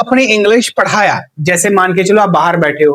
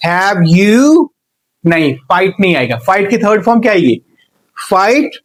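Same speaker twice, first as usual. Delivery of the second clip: ordinary talk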